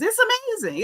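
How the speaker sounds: high-pitched sarcastic marvel; incredulous squeal on AMAZING, put on; mock amazement. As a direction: swoop way up in disbelief.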